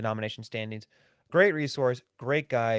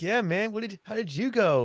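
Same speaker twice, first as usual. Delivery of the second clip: higher, chill bro asking; casual dude voice; 9 semitones higher, 3 dB louder than usual. Retold or imitated. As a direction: ask it like a laid-back buddy. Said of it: "He's like".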